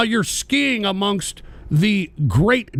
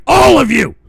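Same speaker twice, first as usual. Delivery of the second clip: high, loud yell; shouting a grudge; furious shout; 3 semitones higher, 7 dB louder than usual. Real, not acted. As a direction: bellow it at them, higher. Angry rant at viewers.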